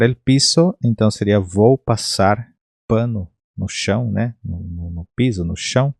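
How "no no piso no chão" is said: ordinary talk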